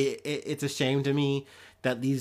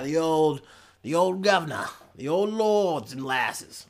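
put-on terrible British accent. Podcaster riffing on medieval Britain, in a terrible British accent. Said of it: "My accents are terrible".

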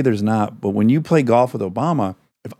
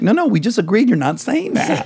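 high-pitched, incredulous inner voice; mock alarmed protest; 12 semitones higher than usual. Inner voice objecting as you blurt it out, mock alarm. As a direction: protest in a raised, pleading pitch. Acting out hearing yourself speak.

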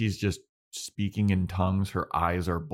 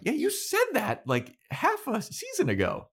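much higher-pitched comeback; high, needling mock objection; mock retort. Podcaster heckling a villain's plan, mock retort. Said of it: "I'm like".

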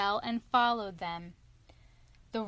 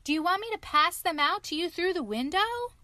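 high, loud storybook shout; small boy hollering a question; 8 semitones higher, 5 dB louder than usual. A narrator's reading. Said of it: "shouted even louder".

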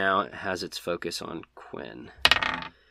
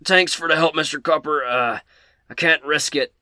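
raised, louder in-character voice; higher, forceful adventurer under pressure; Quinn; 6 semitones higher, 6 dB louder than usual. Acted. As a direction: call out thanks mid-fight, urgently. Quinn says.

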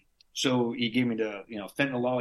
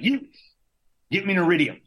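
loud, higher command; bossy order; 7 semitones higher, 5 dB louder, faster than usual. Retold or imitated. Patient ordering a staffer to fetch a phone, loud command. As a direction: snap an order at the guy. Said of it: "it's just me being a prick".

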